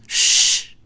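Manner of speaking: long, forceful shh; loud shush. Acted. Stepdaughter quieting the family, loud shush. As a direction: hush the room sharply.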